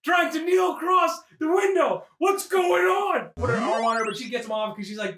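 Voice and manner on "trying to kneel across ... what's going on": high, slow, drawn-out cry; hammed-up slow panic; mock-alarmed shout. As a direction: wail in comic disbelief at an intruder. Acting out alarmed onlooker.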